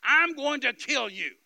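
high, slow retold scream; loud, drawn-out menacing shout; screamed threat. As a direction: act out a gunman's full-voiced yell. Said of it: "And he screamed at me".

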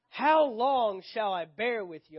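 slow, loud, drawn-out exasperation; frustrated reading, leaning hard on "how long"; Jesus. A narrator's reading. Jesus fed up with his disciples, loudly frustrated. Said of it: "you can just sense the frustration".

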